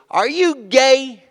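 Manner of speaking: slow, high, drawn-out taunting question; heckler. Retold or imitated. Audience member heckling the comic, slow high taunt. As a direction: drag out the jeer like a heckler.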